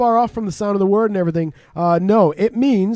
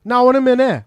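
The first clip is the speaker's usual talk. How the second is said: higher, louder Welsh lilt; Welsh accent; 6 semitones higher, 5 dB louder than usual. Shown as a demonstration. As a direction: put on a punchy Welsh accent. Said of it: "if a Welsh person said to you".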